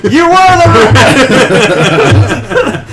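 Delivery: high laughing shout; laughing exclamation. Real, not acted. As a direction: squeal it through laughter. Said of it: "I'm like".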